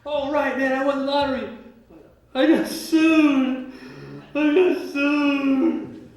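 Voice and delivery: high, loud, very slow mocking wail; mock despair. Mocking someone who just got sued, hammed-up wail. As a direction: drag it out like sarcastic misery.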